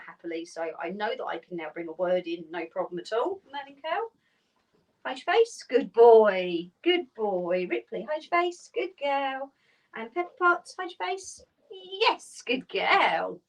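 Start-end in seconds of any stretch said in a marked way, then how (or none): 3.5-13.4 high, bright sing-song calls to the dogs, loud on the praise. Real, not acted. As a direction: cheer each dog on in a high, excited voice. Calling the dogs to the box.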